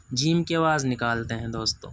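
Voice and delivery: usual speaking voice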